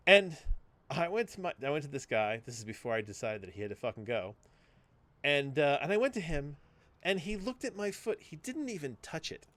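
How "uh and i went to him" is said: normal talking voice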